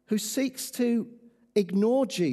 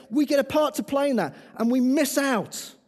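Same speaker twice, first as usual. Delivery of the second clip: rally speech; 6 semitones higher, 5 dB louder than usual. Acted. Rally call to the church.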